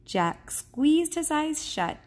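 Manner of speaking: ordinary delivery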